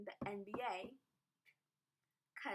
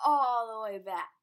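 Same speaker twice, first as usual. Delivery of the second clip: all the way back, much louder and slowed; loud emphasis; 15 dB louder, slower than usual. Shown as a demonstration. Vlogger dramatizing the bent finger for viewers, slow loud emphasis. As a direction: drag it out loud for the visual. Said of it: "let's give you a nice visual".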